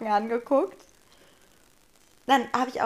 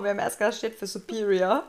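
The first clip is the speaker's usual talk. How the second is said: ordinary talk, nothing marked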